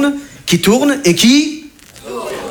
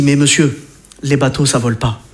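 deadpan operator; 7 semitones lower than usual. Retold or imitated. Ride operator's deadpan reply.

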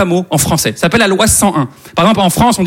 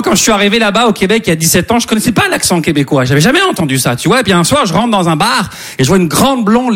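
ordinary talk, nothing marked

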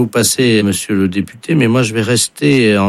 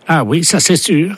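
much higher put-on voice; high deputy voice; 8 semitones higher than usual. Prankster's deputy echoing the interpreter, high put-on voice. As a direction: squeak it out like the fake deputy. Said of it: "same words in the deputy's high voice".

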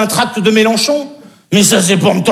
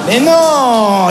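ordinary talk, nothing marked

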